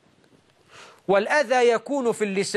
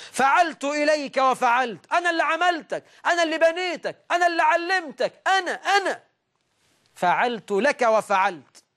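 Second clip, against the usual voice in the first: much higher, bragging voice; boastful benefactor; 8 semitones higher than usual. Retold or imitated. Man reminding another of his favours, high bragging. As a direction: crow about everything you did for him.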